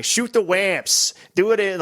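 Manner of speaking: high, drawn-out bad New York accent; exaggerated New York City imitation; mock New York accent. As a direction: do a hammy New York call-out. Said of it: "But then you have the guy".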